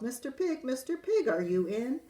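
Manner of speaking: high sing-song call; wolf calls to the third pig, sing-song. Acted. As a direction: call up to the brick house sweetly. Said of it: "I called".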